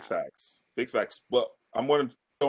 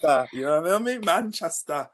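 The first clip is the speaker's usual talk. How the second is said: higher-pitched mock Manchester accent; 3 semitones higher than usual. Claiming to co-hosts he can do a Manchester accent. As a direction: put on a Manchester accent, playfully. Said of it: "we're gonna hear another accent".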